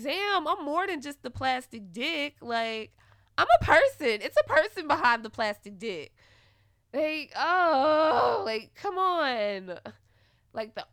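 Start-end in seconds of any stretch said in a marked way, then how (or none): 0.0-6.1 loud, high, fed-up retelling of her own words; exasperated rant. Retold or imitated. Retelling to viewers what she thought of him, loud and fed up. Venting retold frustration at him. 6.9-9.8 loud, high, drawn-out groan of disbelief; exasperated shout. Retold or imitated. Complaining about him only wanting sex, a loud fed-up shout.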